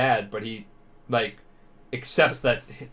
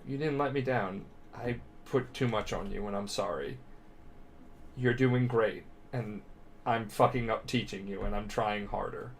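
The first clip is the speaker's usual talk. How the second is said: soft apology; 8 dB quieter than usual. Retold or imitated. All Might apologizing tenderly.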